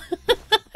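squeaky delighted laugh; a quick high laugh at the sign; high giggle. Real, not acted. Funny broken sign.

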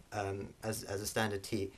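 normal talking voice

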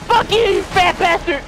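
very high shout of flight and insult; frantic defiant yell. Real, not acted. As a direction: scream abuse while fleeing. Fleeing and cursing the boss.

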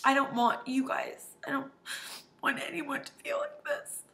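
fighting back real tears mid-sentence; on the verge of tears. Real, not acted. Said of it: "hopefully I won't cry for the rest".

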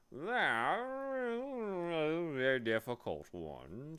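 slow, swooping bee voice; spelling bee. A narrator's reading. Bee correcting Milo's fear, slow pompous creature voice. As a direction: spell it out with drawn-out, sliding letters.

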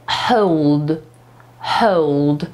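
low, drawn-out hold with a full diphthong; pronunciation of hold. Shown as a demonstration. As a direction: hold with a long 'oh-oo' glide, low.

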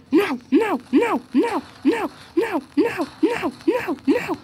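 high, yelped string of noes; frantic repeated no. Real, not acted. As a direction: yelp each no in rapid panic. Frantic refusals during game panic.

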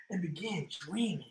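soft whispered narration. A narrator's reading. Storyteller lulls the children to sleep in a whisper. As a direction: whisper as she drifts off. Bedtime story, falling asleep.

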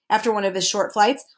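plain reading voice

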